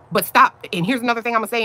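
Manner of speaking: ordinary talk, nothing marked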